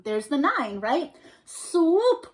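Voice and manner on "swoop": bright call of a mother bat, a bit higher; mother bat crying out. A narrator's reading. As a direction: call the kids to swoop. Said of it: "cried the mother".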